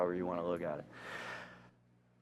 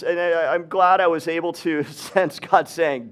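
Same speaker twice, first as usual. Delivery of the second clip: normal talking voice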